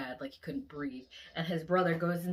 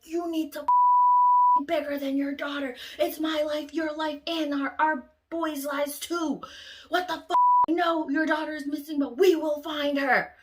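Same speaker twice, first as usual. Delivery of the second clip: much higher, louder, slowed yelling; angry scolding; 9 semitones higher, 11 dB louder than usual. Retold or imitated. Yelling at the friend who left the truck, much louder. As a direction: tear into her for leaving.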